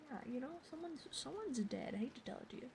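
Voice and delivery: ordinary talk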